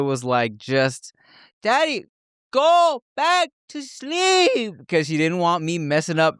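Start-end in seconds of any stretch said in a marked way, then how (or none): 1.6-4.7 put-on much higher, slow sing-song child voice; little girl voice. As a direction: shoo dad away like a toddler. Imitating young daughter.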